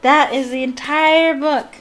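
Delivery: loud, high proud finish; triumphant announcement. Real, not acted. Reader finishing the whole book, loud and triumphant.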